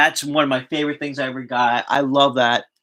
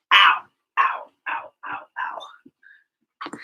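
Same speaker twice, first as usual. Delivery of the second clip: sharp ows of pain; 6 semitones higher than usual. Real, not acted. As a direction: yelp from a sleeping foot. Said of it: "my foot is killing me".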